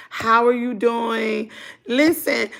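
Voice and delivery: ordinary talk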